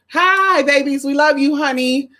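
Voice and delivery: high bright shout-out; excited greeting. Real, not acted. Greeting fans in Sweden excitedly. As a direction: wave hello up high.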